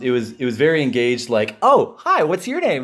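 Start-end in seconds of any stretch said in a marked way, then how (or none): 1.6-2.8 high, bright mock greeting voice; chirpy friendly greeting. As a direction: imitate an eager hello, pitched up. Imitating Jason meeting them.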